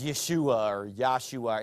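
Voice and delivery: Yeshua and Yahshua sounded out slow and low; mock pronunciation correcting. Pastor mimicking somebody correcting the name, slow mock-careful pronunciation. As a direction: say Yeshua, then Yahshua, as fussy alternatives. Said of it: "how to pronounce a name".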